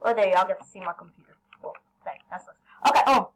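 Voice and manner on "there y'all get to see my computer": usual speaking voice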